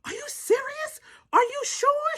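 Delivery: high, thin mock wail; imitating tearful disbelief; bawling. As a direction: plead in a very high crying voice. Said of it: "I started bawling like".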